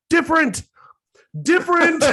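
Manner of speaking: high, loud yapping words; translated dog bark. Retold or imitated. Host translates his littlest dog's bark, yapping. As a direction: yap it like an alarmed little dog. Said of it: "her bark, in, if you could translate".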